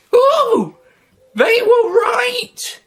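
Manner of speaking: put-on high, loud, slow dying cry of an old woman; she's been hit, cry it out. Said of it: "cried the old woman".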